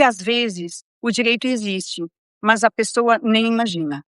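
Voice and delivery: normal talking voice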